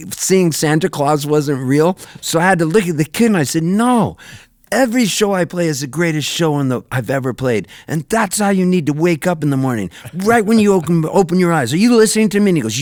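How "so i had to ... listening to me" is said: earnest pep talk. Retold or imitated. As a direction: drill it into the kid with fervour. Lecturing the kid intensely.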